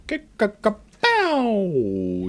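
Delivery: high, punchy cartoon fight sounds; playful kung-fu sound effects; mock fight noises. Imitating combat noises.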